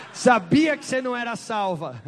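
laughing through the line; can't hold back the laugh. Real, not acted. Interpreter laughs translating.

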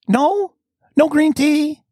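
high, mock-hopeful questioning; disappointed plea. Acting out his disappointed question.

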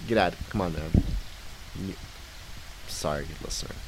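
ordinary delivery

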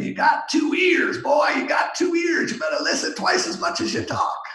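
hammed-up Southern accent, yelled high; Southern boss impression. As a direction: bark it like a big Southern boss lecturing a young salesman. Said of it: "he was a big Southern guy".

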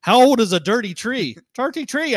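high, loud mock Irish "Dirty tree"; a hammed-up Irish accent, amused. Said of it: "I said".

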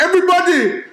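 very high mock Middle Eastern call; imitated Middle Eastern accent, stretched and high. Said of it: "he got this crazy Middle Eastern accent".

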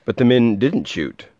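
plain reading voice

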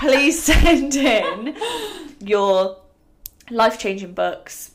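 laughing through the words; words broken by laughter. Real, not acted.

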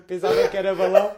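loud incredulous repeat; loud exclaim. Retold or imitated. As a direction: belt out pensava que era balão in disbelief.

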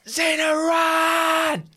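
desperate shout to run. Acted. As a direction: yell it across the room. Calling out to ally.

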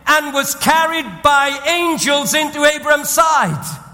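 high, loud, driving sermon voice; full-force preaching. Acted. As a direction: preach it out to the whole hall.

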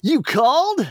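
high, bright-pitched hero voice; Sonic voice. Acted. Sonic turning to the fox who called him, high cartoon hero voice. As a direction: answer like a cocky cartoon hero. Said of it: "said Sonic".

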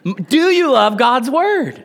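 rally the crowd to answer. Real, not acted. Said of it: "Come on".

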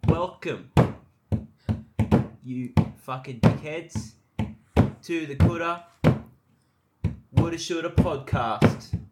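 loud, slow, mock-epic welcome; grand announcer intro. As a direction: boom it out like a hammy host.

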